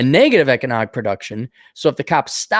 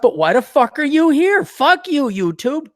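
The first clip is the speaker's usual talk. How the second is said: high-pitched, exasperated outburst; real anger; 9 semitones higher than usual. Real, not acted. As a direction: rant it like you're truly fed up. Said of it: "I'm, I'm real mad".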